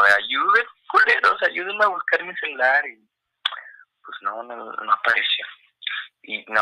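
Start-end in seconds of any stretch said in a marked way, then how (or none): 0.1-2.9 high, wailing mock plea, pitch jumping up on culeros; squeaky hammed-up crying plea; crying plea for help. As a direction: retell the begging like a kid in tears, half comic. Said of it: "básicamente llorando".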